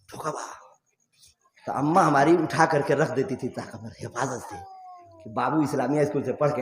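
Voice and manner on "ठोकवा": whispered ठोकवा, barely voiced. Real, not acted. Repeating the word softly. (फुसफुसाकर ठोकवा)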